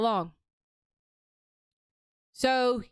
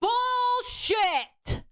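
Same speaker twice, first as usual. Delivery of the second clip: high shouted outburst; shouted bullshit; 13 semitones higher than usual. Real, not acted. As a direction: yell it in disbelief. Shouting at the post.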